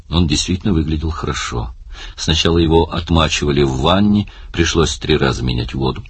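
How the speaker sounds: plain reading voice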